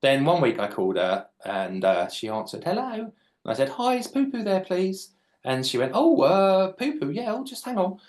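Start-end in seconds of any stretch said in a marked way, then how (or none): 2.6-3.1 high, lightly voiced old lady. Old lady answering the prank caller, high and frail. 3.7-5.1 very high, sugary innocent ask; fake-polite kid voice, pitched high; cheeky prank voice. Boy prank-calling an old lady, high and sugary. 6.0-8.0 put-on high, fluttery old lady; sweet granny surprised then obliging. Old lady agreeing to fetch Poo Poo, high and sweet.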